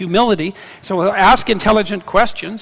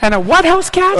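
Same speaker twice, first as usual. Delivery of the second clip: much higher, louder, puzzled; incredulous question; 10 semitones higher, 4 dB louder than usual. Real, not acted. Guest asking host to repeat the word, incredulous. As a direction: ask back as if you misheard.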